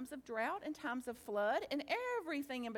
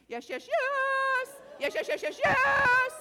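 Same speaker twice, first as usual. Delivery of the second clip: loud, higher, drawn-out yeses ending in a laugh; choir shout; 9 semitones higher, 12 dB louder, slower than usual. Real, not acted. Leading the audience in a choir-like answer, loud shout. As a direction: belt it out like a choir. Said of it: "Let's have it like a choir".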